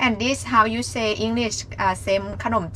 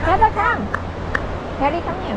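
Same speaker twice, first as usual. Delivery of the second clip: calling a dog; 6 semitones higher than usual. Real, not acted. Calling the dogs.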